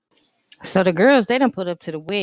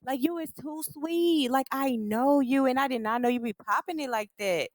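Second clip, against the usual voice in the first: mocking Ming; 8 semitones higher than usual. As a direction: stretch it like a shocked friend. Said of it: "she like".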